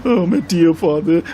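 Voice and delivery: high, loud imitation of weeping; tearful reader, imitated; mock crying. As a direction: wail it like a funeral eulogy, hammed-up. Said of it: "was crying".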